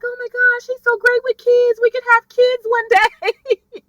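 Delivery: high, swooning mock lovestruck voice; smitten gushing. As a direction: gush dreamily in a put-on high pitch. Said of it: "So Quinn is all in love".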